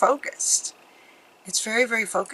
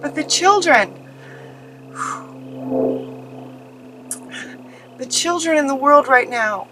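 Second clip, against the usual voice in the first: holding back tears; 4 semitones higher than usual. Real, not acted. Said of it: "I don't wanna cry".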